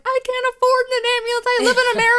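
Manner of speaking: high, loud, incredulous protest; exasperated retort. Retold or imitated. Telling her aunt she can't afford an ambulance, high loud disbelief. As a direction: blurt it out, almost squeaking with disbelief.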